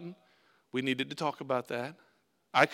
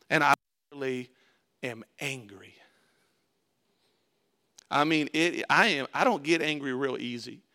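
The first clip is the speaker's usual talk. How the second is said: ordinary talk, nothing marked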